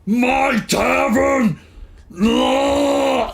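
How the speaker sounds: slow, high, loud wail of outrage; anguished shout. Acted. Ogre barkeep watching his tavern hit, slow high outraged shout. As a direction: howl over the loss. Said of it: "and he goes".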